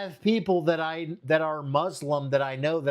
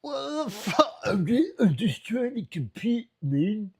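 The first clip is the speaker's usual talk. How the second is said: mocking British bloke, higher and pushed; mock British accent; 6 semitones higher than usual. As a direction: sneer it in a hammed-up British accent. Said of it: "Fucking stupid Brit bonger".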